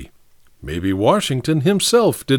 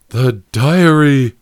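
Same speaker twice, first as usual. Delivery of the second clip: moaned; 2 semitones higher, 6 dB louder, slower than usual. A narrator's reading. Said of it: "moaned Professor Shaw".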